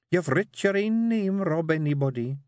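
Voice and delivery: high Scots wifely voice; a firm wee wife steering her man; Jeanie coaxing. Acted. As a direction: Jeanie urging her husband to write.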